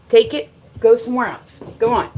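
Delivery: real exasperated order; firm command. Real, not acted. Sending the dog away.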